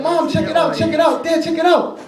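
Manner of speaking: put-on high child voice, eager; high eager kid voice; excited boy. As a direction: kid begging parents to look. Boy shows parents saxophone.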